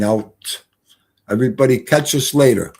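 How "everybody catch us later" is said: ordinary talk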